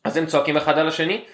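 normal talking voice

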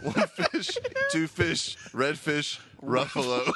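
measured, one phrase at a time with pauses; slow storybook reading. Pretend. Friend mock-reading a Doctor Seuss book, slow and measured. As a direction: read it like a picture book, leaving gaps. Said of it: "You gotta say it fast, though".

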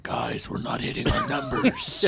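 high, strained demon boss. Pretend. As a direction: squeeze it into a silly demon. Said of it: "It's a good demon voice you did".

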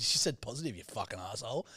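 usual speaking voice

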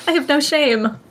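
normal talking voice

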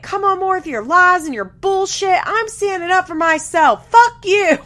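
high, loud screaming rant; mock screaming. Leah screaming at Corey on the phone, hammed-up scream. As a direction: build to a full yell on the last words. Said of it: "Leah starts screaming".

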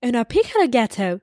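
put-on Italian words in an English accent, lightly done; mock Italian phrase-book line with an English accent; English accent faking Italian. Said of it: "l'accent anglais qui veut faire l'accent italien".

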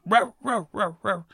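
high imitated barking, fading off; mock dog barks; big dog bark. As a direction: mimic a dog barking through a fence. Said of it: "And he barks at me".

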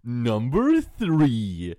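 slow, loud countdown announcer; Watch Mojo. Acted. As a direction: stretch it out as an online list-video host. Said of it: "on brand with the Watch Mojo theme".

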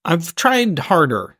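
ordinary talk

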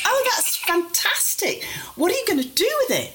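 bright humouring voice. Retold or imitated. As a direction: humour him with high cheerful delight. Daughter humouring confused father.

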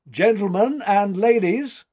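loud, high ringing announcement; official announcer proclamation. A narrator's reading. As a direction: proclaim it to the whole room. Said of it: "he proclaimed".

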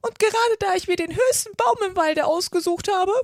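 high, loud pigeon voice. A narrator's reading. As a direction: raise the voice in shrieking grievance. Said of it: "mit erhobener Stimme fort".

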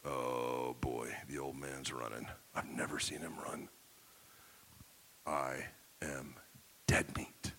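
very low, hushed dread; dreading son. Acted. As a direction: mutter to yourself as if doomed. Son dreading his running father.

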